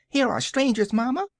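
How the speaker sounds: put-on high-pitched excited child crying out; shrill little kid calling to mamma; shrill childish voice. A narrator's reading. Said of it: "the shrill and childish voice".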